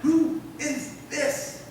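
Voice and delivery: high, slow, hushed wonder; stunned disciples. Acted. Each man talking to the next, stunned wonder. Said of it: "each man talking to the next".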